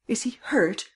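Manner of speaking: high, worried question; acted high-pitched worry; anxious cry. A narrator's reading. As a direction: ask it anxiously, pitched right up. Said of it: "cried Miss Cummins anxiously".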